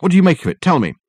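high, loud, pushing; can't wait for her answer; eager demand. Acted. Dirk pressing Miss Pierce, eager loud demand.

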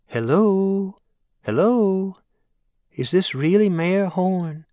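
high, sweet Southern lady's voice, slowing on the question; a sweet older caller drawling into the phone. A narrator's reading. Sweet caller asks the mayor on air, high drawl. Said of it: "Betsy Ribbon asked in her sweet drawl".

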